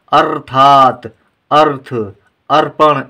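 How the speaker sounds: अर्थात, अर्थ, अर्पण each with a crisp r before the next sound; teacher modelling correct pronunciation; clear r before the consonant. Shown as a demonstration. Teacher shows pupils the correct r sound, slow and crisp. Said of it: "सही उच्चारण होता है रे करके".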